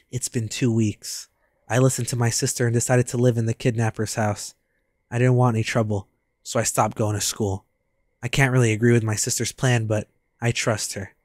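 low, soft inner-monologue read; subdued first-person narration. Acted. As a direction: narrate the boy's thoughts low and quiet. Reads the character's inner monologue.

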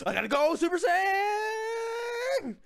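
high, long drawn-out yell; playful hero powering up; anime power-up. Pretend. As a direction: stretch the last word into a charging scream.

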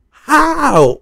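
high, crying wail of disbelief; mock wail. Fan wailing at the fight result, high mock cry. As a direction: howl it like a heartbroken fan.

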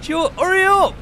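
very high, loud call to hurry; shouting to the scooter driver in front; teasing shout. Real, not acted. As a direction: holler at him to get a move on. Calling out to slow scooter driver.

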